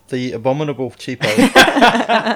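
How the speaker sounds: announcer voice. Acted. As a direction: stretch it out like a ring announcer. Co-host copies the announcer intro.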